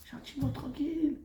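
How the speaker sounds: high, very soft croon; gentle, relaxed. Shown as a demonstration. Rabbi showing how to remind a wife, gently. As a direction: coo it softly up high. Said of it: "Mais comment tu le dis?".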